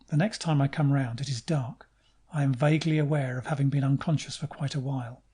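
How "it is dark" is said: plain reading voice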